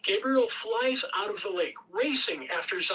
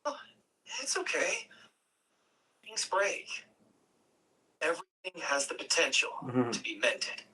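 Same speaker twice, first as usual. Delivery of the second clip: lightly voiced Gabriel, gentle and reassuring; 3 semitones lower than usual. Gabriel reassuring Zion about the broken pillar, gently.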